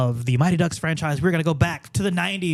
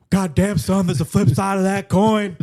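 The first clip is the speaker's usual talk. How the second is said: louder gruff wrestler growl; 2 semitones higher, 4 dB louder than usual. Acted. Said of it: "doing [name]'s voice".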